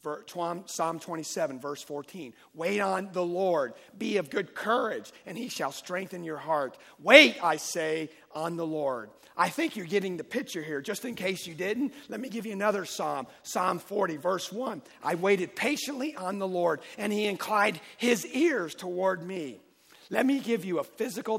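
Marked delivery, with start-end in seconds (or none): 2.5-6.8 loud, ringing pulpit delivery; full-force preaching. Acted. Pastor driving the verse home to the congregation, preaching loudly. 7.0-8.1 a big, slow cry of wait; loud slow exhortation. Acted. Pastor exhorting his church to wait, shouting slowly. 8.3-21.4 loud, ringing pulpit delivery; full-force preaching. Acted. Pastor driving the verse home to the congregation, preaching loudly.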